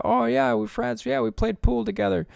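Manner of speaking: high naive friend admitting the friendship. Pretend. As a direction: answer cheerfully, not knowing the danger. Naive friend voiced in scenario.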